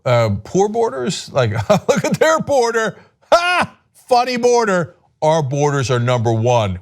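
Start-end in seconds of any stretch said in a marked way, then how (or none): 1.7-4.9 high, slow mocking laugh at the border; hammed-up taunting voice; mock foreigner. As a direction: sneer like someone pointing and laughing.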